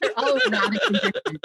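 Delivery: laughing, pitch jumping up; giggling through the reply. Real, not acted. Guest laughing as she answers the host's teasing.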